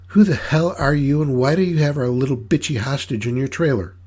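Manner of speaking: high-pitched villainess, demanding answers; sweet-voiced menacing matriarch. Mama questions her tied-up captives, sweetly demanding. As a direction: interrogate in a high, sweet lady's voice.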